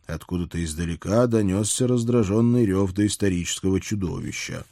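ordinary delivery, nothing marked

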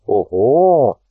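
loud, drawn-out exclamation of wonder; impressed surprise. A narrator's reading. Lunin sees the drawing take shape, loudly impressed. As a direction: let out a long, impressed whoop. Admiring a newly drawn portrait.

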